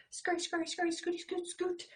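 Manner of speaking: high, quick scurry chant; scurrying spider. Acted. Teacher voicing a little spider scurrying. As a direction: patter like tiny legs.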